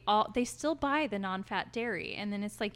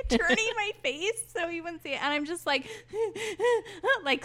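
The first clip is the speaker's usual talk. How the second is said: laughing; 9 semitones higher, 5 dB louder than usual. Real, not acted. Laughing while telling crying story.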